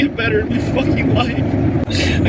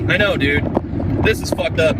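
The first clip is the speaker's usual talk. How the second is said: usual speaking voice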